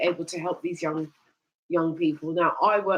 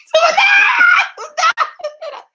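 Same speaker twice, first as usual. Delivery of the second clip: very high, loud laughing shriek; 12 dB louder than usual. Real, not acted. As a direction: burst into a squealing laugh. Dog shook water on her.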